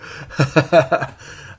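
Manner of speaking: airy unvoiced chuckle; breathy laugh. Real, not acted. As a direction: laugh under your breath. Laughing at listener's birth year.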